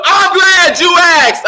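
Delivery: high, loud, drawn-out exclamation; hammed-up excited answer. Announcer answering his own planted question, hammed-up and excited. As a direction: burst out high and loud, stretching it.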